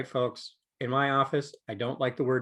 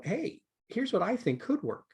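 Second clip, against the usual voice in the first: high tentative voice; 9 semitones higher than usual. Retold or imitated. Voicing a tentative suggestion.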